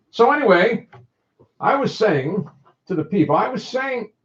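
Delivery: high-pitched dummy-style voice; high, put-on puppet talk; put-on show voice. Shown as a demonstration. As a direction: carry on talking as a high puppet. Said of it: "rest of the show like this".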